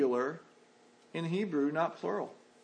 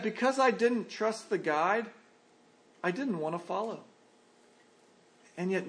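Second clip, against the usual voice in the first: ordinary talk, nothing marked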